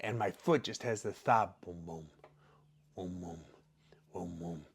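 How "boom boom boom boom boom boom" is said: very soft low boom boom like a heartbeat; throbbing pulse. Retold or imitated. Telling fans how the foot throbbed, soft pulsing booms. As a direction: mimic the pain pulsing quietly.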